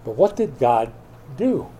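normal talking voice

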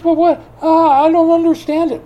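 high, loud baffled voice; mock puzzled leader, high and loud; confused Pharisee. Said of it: "He goes".

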